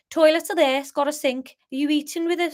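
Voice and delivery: botched Scouse with a Brummie sound; Scouse gone Birmingham. Acted. Pub landlord asking guests about dinner, accidental Birmingham accent. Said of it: "That's Birmingham, isn't it?".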